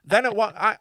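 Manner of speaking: usual speaking voice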